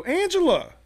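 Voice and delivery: high call across the street; calling her name. Retold or imitated. Calling out from car.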